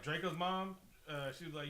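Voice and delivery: ordinary talk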